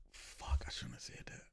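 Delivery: very soft, fully whispered regret; whispered aside. Real, not acted. Host catching a slip in what was said, whispered. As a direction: whisper it to yourself, under your breath. Takes back a swear word aside.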